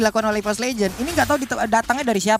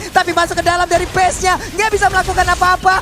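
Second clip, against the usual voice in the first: esports caster; 3 semitones higher, 4 dB louder than usual. Real, not acted. Live play-by-play of final fight.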